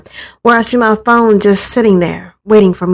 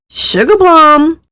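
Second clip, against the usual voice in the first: drawn-out call from another room, slow and higher; dad calling out; 8 semitones higher, 4 dB louder, slower than usual. Retold or imitated. Dad calling his sleeping daughter to breakfast, long loud call. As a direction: sing out the pet name to wake her.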